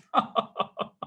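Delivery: high hearty chuckle; old man laughing with delight; merry laugh. Acted. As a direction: laugh joyfully. Scrooge laughs merrily.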